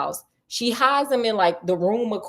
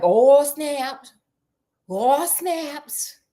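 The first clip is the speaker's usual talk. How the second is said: high, drawn-out exclamation; mock alarm; 5 semitones higher, much slower than usual. Sherlock sees the candle stop abruptly, mock alarm. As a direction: stretch it out in playful shock.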